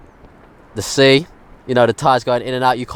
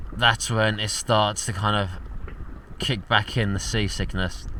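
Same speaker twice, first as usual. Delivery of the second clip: softer, low, drained voice; seasick and weak; 5 semitones lower, 9 dB quieter than usual. Real, not acted. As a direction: speak quietly, worn out. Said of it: "I feel absolutely terrible".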